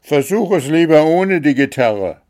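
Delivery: high, loud plea; shouting over music. A narrator's reading. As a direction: call it out over a din. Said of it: "versuchte Wuschel dagegen anzubrüllen". (laut und hoch gerufen)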